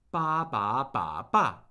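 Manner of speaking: "ba" in quick level, rise, dip, fall sequence; four Mandarin tones. Shown as a demonstration. Teacher recapping the warm-up, four tones run together on "ba". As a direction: chain all four tones on "ba". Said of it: "the four tones of Mandarin Chinese".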